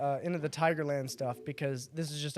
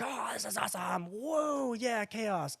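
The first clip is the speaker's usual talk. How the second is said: higher, held-back whooping, retold; mock cheering; 4 semitones higher, 5 dB quieter, slower than usual. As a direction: imitate a thrilled cheer without volume. Acting out their delight at chaos.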